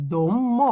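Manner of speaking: দম্য drawn out slowly; pronunciation demo. Shown as a demonstration. Teacher to students, slowly finishing অদম্য. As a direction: stretch দম্য to show the syllable. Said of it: "এটা হচ্ছে অ".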